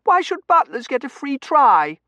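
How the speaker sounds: put-on high, loud, indignant child; piercing childish protest; protesting little girl. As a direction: complain like a thwarted child.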